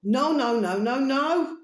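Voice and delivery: a chant of stubborn refusal; emphatic no. Acted. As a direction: refuse it flat out, each no punched. Defiant refusal of the bins.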